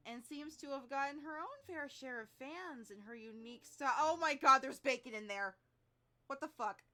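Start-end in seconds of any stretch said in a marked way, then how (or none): 4.0-6.8 loud shocked gasp of disbelief; shocked outburst. Real, not acted. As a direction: blurt it out in shock.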